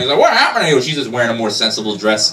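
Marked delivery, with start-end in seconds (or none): 0.2-0.8 high, shocked cry; horrified. Retold or imitated. George finds Mary in a sensible dress, shocked and high. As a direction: recoil at the sight of her.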